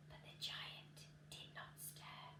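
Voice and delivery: hushed whisper; whispered narration, holding breath. A narrator's reading. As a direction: breathe it so the giant won't wake. Tense moment in story.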